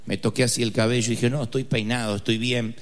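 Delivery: usual speaking voice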